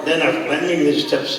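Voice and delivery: usual speaking voice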